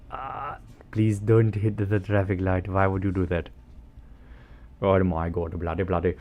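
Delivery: lightly voiced exasperated passenger in a put-on voice; mildly hammed-up exasperation. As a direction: plead with a bad driver, mock exasperation. Acting out exasperated passenger scolding driver.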